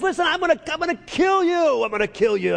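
high, loud mock giant threat; hammed-up bully trying to intimidate; a hint of Goliath taunting David. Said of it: "And he says it loud".

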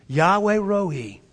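with conviction. Shown as a demonstration. Preacher urging the church to echo him, with conviction. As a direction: lift the pitch and declare it slowly. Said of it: "Say it with conviction".

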